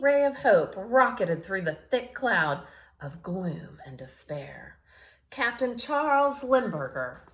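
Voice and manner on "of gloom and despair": whisper. A narrator's reading. Narrating gloom and despair.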